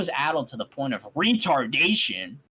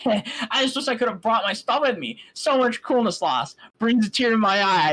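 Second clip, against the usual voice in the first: mock lament; 8 semitones higher, 6 dB louder than usual. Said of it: "I joked".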